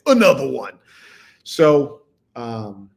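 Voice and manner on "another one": loud catchphrase impression. Host answering viewers about one more scam, loud catchphrase imitation. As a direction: boom it out like a hype-man slogan. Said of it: "like [name] said".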